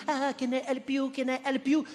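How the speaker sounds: a chirpy, hovering shop assistant; eager American clerk. Retold or imitated. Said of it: "they are all on top of you".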